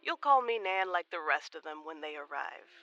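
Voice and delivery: ordinary talk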